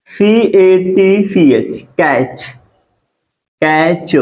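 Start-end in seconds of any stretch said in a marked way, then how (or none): 0.1-1.8 letters spelled slowly one by one; slow spelling out. Shown as a demonstration. Teacher spelling the word for students, slow and clear. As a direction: spell out each letter, drawn out. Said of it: "टी के बाद क्या होता है? सीएच".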